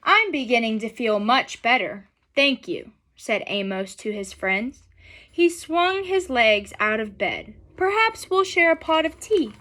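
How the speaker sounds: gentle, unhurried storybook reading; reading to children. A narrator's reading. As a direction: read it aloud to a class of kids.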